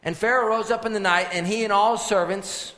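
preacher reading out loud and high; forceful scripture preaching. Acted. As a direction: proclaim the verses to the whole congregation. Reads scripture at full preaching force.

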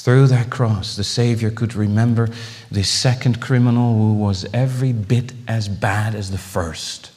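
ordinary talk

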